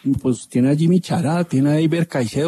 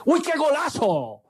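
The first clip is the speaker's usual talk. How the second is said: breakneck goal commentary; 11 semitones higher, 4 dB quieter than usual. Acted. Radio goal call begins.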